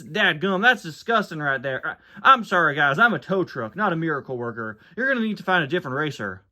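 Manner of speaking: apologetic country tow truck voice; Tow Mater. Acted. Mater turns down an impossible towing job, apologetic. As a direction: back out of the job sheepishly. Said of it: "Tow Mater Tries to Convince Someone".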